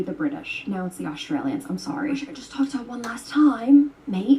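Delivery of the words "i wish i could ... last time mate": hammed-up Australian accent, louder, ending on mate; jokey, badly faked Australian accent, louder; mock Australian accent. Shown as a demonstration. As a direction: lay on a cheesy Australian accent. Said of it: "insult somebody with a different accent".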